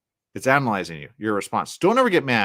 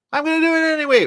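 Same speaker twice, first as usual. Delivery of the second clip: put-on high, loud bratty kid voice; a child defying the parent, high and loud; defiant child; 7 dB louder, faster than usual. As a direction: sass back like a stubborn little kid. Defiant child answering back.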